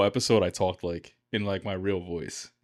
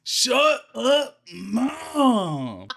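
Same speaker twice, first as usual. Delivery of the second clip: squeaky high shout at mom; mock high-pitched yell; 6 dB louder, much slower than usual. Teen snapping at mom in a squeaky shout. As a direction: yell it up high like a bratty kid. Comic high put-on quote.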